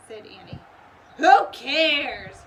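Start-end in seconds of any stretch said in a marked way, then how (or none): 1.2-2.4 loud, much higher roar; pirate roar. A narrator's reading. Captain Bones roars at Annie, loud and scornful. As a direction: bellow it dismissively. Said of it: "roared Captain Bones".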